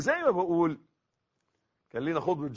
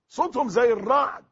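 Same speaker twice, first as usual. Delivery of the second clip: loud, raised preaching cry; thunderous emphasis; 8 semitones higher, 10 dB louder than usual. Shown as a demonstration. Preacher warning listeners about the angels' voices, thunderous emphasis. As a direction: drive it home at a high, forceful pitch.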